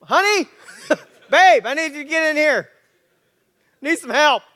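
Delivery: loud, high, hammed-up call; mock calling out. Husband calling his wife in to help, hammed-up shout. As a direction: yell across the house for help.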